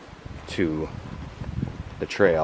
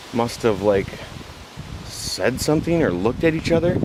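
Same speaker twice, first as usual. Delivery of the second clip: ordinary delivery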